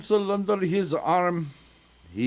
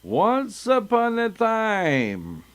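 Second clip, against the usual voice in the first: higher, slow, grand "Once upon a time"; storybook opening; 5 semitones higher, slower than usual. Shown as a demonstration. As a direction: open the tale like a grand storyteller. Grand story opening, then mocked.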